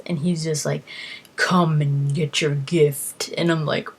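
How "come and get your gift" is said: creepy Daedra voice. Retold or imitated. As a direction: lure them in, sinister and slow.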